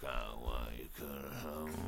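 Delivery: low growling villain voice; Thanos impression. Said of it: "good Josh Brolin impression".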